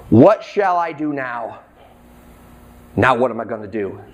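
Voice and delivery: low, pondering inner voice; talking to himself. Acted. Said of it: "The manager said to himself".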